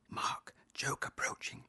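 hushed tip-off, like a secret cue; whispered aside. Pretend. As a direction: whisper the warning. Secret warning to the son.

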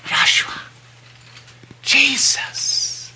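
soft breathy whisper of the name. Retold or imitated. Holy Spirit speaking the name Jesus to him, whispered. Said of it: "just saying the word Jesus".

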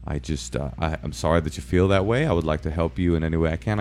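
low, calm police officer; measured, soft-spoken reassurance. Retold or imitated. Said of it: "like, very calm".

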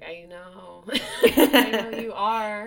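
laughing through a sheepish apology. Retold or imitated. Laughing apology to an ex-boyfriend, sheepish and giggly. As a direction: giggle out the sorry.

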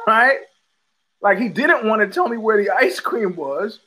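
ordinary delivery

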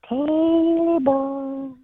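high, slow, drawn-out ghostly wail; haunting voice. Acted. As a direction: moan it like a ghost in the woods. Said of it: "he hears".

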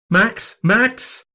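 high, worried cry of the name; alarmed calling. A narrator's reading. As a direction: call to rouse a fainted son. Mum calls fainted son.

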